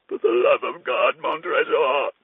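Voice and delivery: high, pleading cry; desperate plea. Acted. Trapped friend begging his captor, desperate plea. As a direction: beg for your life.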